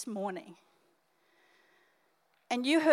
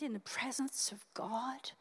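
whispered; 11 dB quieter than usual. Real, not acted. Pastor whispering the last word of a plea to the church. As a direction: drop to a breathy whisper on God.

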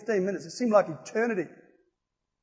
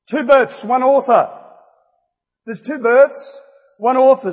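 loud, higher preacher's slogan; emphatic proclamation; 6 semitones higher, 9 dB louder, slower than usual. Real, not acted. Preacher telling congregation there's two births, emphatic proclamation. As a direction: drive each phrase home to the congregation.

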